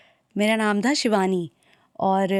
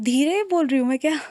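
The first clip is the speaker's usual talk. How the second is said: higher, taken-aback query; surprised question; 4 semitones higher than usual. Real, not acted. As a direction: ask in real surprise whether it's true. Reacts to being called soft-spoken.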